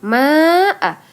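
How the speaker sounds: "ma'a" long, high and loud before the hamzah; mad wajib muttasil. Shown as a demonstration. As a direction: stretch the "ma" of "ma'a" for five beats. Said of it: "disebut dengan mad wajib muttasil".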